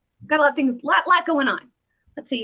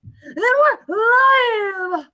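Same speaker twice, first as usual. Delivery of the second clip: high, loud, stretched-out call; hammed-up announcement; 10 semitones higher, 6 dB louder, much slower than usual. Host telling viewers the show is on, showy shout. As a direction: holler it out like a show opener.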